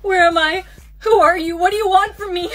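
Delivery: loud, alarmed questioning; frightened demand. Acted. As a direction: question your captors in panic. Captive wakes among strangers.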